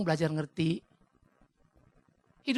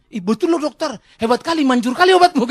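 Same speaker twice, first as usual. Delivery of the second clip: loud, high, delighted patient; hammed-up excited marvelling; amazed praise; 5 semitones higher, 10 dB louder than usual. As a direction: gush loudly over the doctor's medicine. Cured patient praising the doctor.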